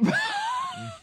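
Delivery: a squealing cry of laughter; high wheezing laugh. Real, not acted. Laughs hard.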